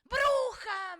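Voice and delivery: loud, high cry of witch; screamed accusation. Retold or imitated. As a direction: scream it across the room like an accusation. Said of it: "levanta un grito y me grita". (grito acusador, fuerte y agudo)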